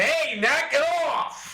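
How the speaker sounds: high, stretched-out scolding yell; intimidating yell. Acted. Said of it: "be that loud".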